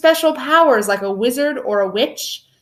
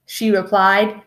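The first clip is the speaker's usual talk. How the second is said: ordinary delivery, nothing marked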